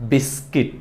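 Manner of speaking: "বিস্কিট" with a short i in the second syllable, bis-kit; correct pronunciation. Shown as a demonstration. Teacher models the right word for learners, correct pronunciation. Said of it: "এটাকে আমরা বলবো".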